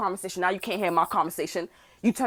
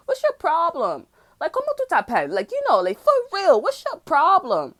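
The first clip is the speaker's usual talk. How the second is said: higher, louder, slowing down to challenge him; escalating challenge; 7 semitones higher, 5 dB louder than usual. Retold or imitated. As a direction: build the challenge up and up. Confronting him, rising.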